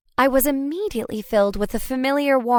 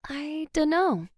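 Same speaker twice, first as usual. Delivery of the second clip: hesitant; 5 semitones higher, 6 dB quieter, slower than usual. A narrator's reading. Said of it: "I hesitated".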